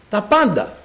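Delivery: ordinary talk, nothing marked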